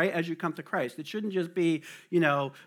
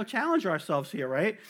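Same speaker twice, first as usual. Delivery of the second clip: normal talking voice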